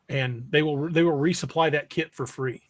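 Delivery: ordinary delivery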